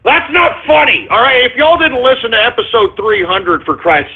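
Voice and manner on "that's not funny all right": high-pitched indignant telling-off; angry scolding. Real, not acted. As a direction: cut the prankster off angrily, pitch raised. Host scolds prankster over clip.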